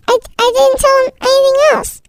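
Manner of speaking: high, quick protest; defensive denial. Acted. Child answers her mom's questioning, quick high-pitched defensive denial.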